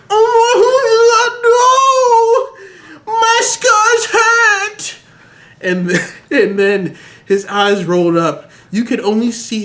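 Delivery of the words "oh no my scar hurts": high, slow wailing cry; screaming in pain. Acted. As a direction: wail it like a melodrama hero. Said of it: "he started to scream".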